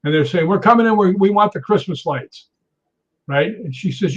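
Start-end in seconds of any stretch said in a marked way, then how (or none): 0.5-2.5 higher, pushy mock demand; demanding intruders. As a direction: imitate men barging in at a gate. Acted quarrel at gate.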